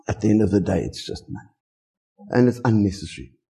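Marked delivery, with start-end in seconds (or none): 0.8-1.5 whispered aside. Real, not acted. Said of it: "repeat of and it's just money".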